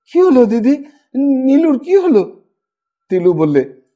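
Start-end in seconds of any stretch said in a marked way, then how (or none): 0.1-2.2 out of breath. A narrator's reading. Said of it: "হাঁপাতে হাঁপাতে বললে".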